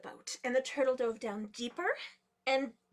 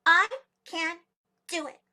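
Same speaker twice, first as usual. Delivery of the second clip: small creature proudly announcing, higher-pitched; proud declaration; 5 semitones higher, 10 dB louder than usual. A narrator's reading. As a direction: declare it proudly, word by word with pauses. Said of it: "very proudly and said".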